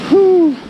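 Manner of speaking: loud high winded exhale; breathless whew. Real, not acted. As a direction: blow out a tired whew. Panting on steep climb.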